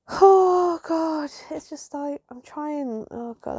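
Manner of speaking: high, wobbling on the edge of tears; trying not to cry. Real, not acted. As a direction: hold back tears as you speak. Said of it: "I don't wanna cry".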